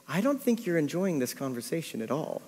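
soft, retold awkward realisation; sheepish aside. Talker to unsuspecting party guest, sheepish aside. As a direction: drop the volume for an awkward admission.

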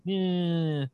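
high nasal-free dorky "yeah"; nerd voice. Retold or imitated. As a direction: play the awkward nerd. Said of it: "I could hear our brother making a nerd voice".

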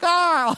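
a wife recoiling from a tossed fortune cookie; startled shriek. Retold or imitated. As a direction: shriek as if the cookie could bite. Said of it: "she's like".

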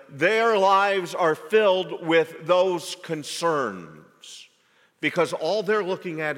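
loud, high fervent preaching; preaching at full force. Acted. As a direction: thunder it from the pulpit.

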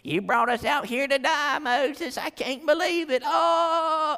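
high-pitched mock whine; hammed-up bellyaching; whining complaint. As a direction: moan like a put-upon follower. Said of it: "just whining and complaining".

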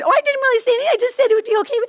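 very high-pitched innocent protest; mock backtracking. Guilty wife excusing herself to husband, mock innocent backtracking. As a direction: act out wriggling out of it, pitched right up. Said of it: "I'm trying to, like, backtread".

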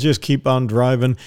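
usual speaking voice

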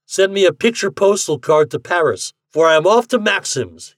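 high, loud parting cry; calling out. A narrator's reading. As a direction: shout it back from the moving cab. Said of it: "he cried".

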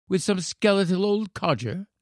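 put-on high-pitched sulky child; complaining boy. A narrator's reading. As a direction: grumble as a put-upon little boy. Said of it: "said the boy".

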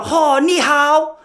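high, loud, drawn-out mock Chinese greeting; exaggerated Chinese accent, loud and slow; mimicked Chinese accent. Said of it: "go up to a little Chinese kid".

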